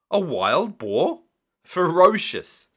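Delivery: slow, high, loud alarm; slow, loud fearful claim; frightened. A narrator's reading. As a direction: ham up the danger. Exaggerating the danger.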